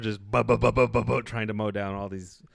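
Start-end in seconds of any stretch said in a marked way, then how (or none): 0.3-1.2 rapid stuttered gunfire noise; mouth-made rattle of machine-gun fire; machine-gun imitation. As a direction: spit out mock machine-gun bursts. Imitating machine guns firing.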